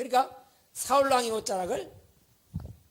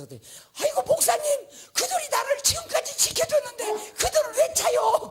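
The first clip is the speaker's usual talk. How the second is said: high, put-on old woman's protest; old deaconess; 4 dB louder than usual. Old deaconess scolding the pastor, high and indignant. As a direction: squeal like an old lady defending her stone. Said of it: "나한테 그러는 거야".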